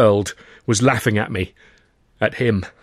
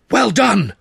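bright, higher congratulating voice; hearty praise; 11 semitones higher, 6 dB louder than usual. Acted. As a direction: clap him on the back with your voice. Tutor praising narrator after show.

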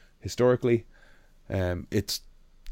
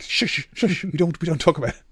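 hushing; 8 semitones higher, 4 dB louder than usual. Retold or imitated. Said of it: "It's like".

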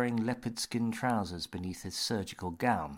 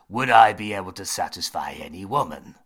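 firm; 9 dB louder, faster than usual. Acted. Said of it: "said James firmly".

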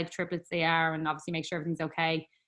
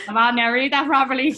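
loud; 6 semitones higher, 9 dB louder than usual. Retold or imitated. Retold playful scolding.

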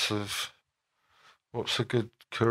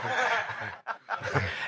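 laughing; 12 semitones higher, 7 dB quieter than usual. Real, not acted. Laughing at the Cockney accent.

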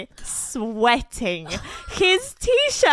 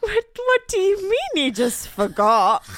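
indignant quote; 9 semitones higher than usual. Retold or imitated. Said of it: "I was like".

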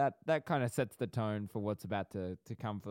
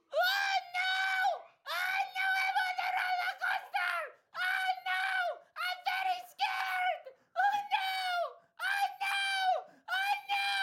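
hammed-up terrified shriek at the very top of the voice; screaming Mort; 3 dB louder than usual. Scared rider on the roller coaster, terrified squealing screams. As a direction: wail in a tiny creature's piercing squeal. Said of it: "There was a lot of screaming".

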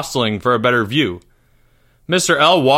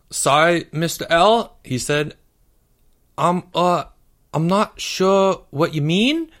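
put-on higher, halting boy voice; hesitant schoolboy Porge; 6 semitones higher than usual. A narrator's reading. Porge answers his teacher's question, hesitant boy voice. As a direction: play a boy stalling with an innocent question.

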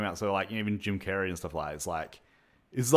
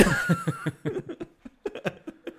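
big squealing laugh. Real, not acted. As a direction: burst out laughing high. Big laugh at film bit.

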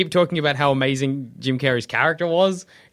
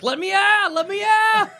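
high, strained mock scream; caged beast wailing to be freed; screaming animal; 3 dB louder than usual. Zoo animals begging to get out, in a high mock scream. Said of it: "animals screaming like".

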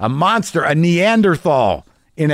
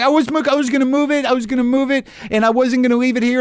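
much higher, fast, whiny excuses; whiny backpedaling guy; 11 semitones higher, faster than usual. Retold or imitated. Neighbor backpedaling to the angry driver, high whiny pleading. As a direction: squeak out excuses like a scared little guy. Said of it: "He's like".

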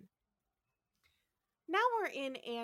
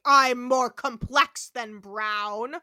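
loud, ponderous monster proclamation; Rock, ancient android; 2 semitones lower, 6 dB louder than usual. Retold or imitated. Ancient machine tells Kirk its origin, booming grandiose voice. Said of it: "And Rock is like".

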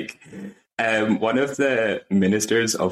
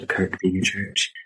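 ordinary talk

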